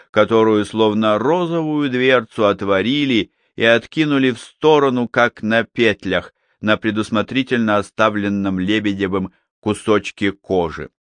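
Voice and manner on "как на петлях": plain reading voice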